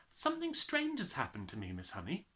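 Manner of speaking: put-on little girl telling her teacher something strange; young girl Matilda. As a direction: voice the small girl, puzzled.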